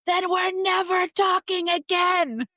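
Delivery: high, slow, loud mock teen wail; sulky son. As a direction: whine it like a hurt kid. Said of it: "Dominic was like".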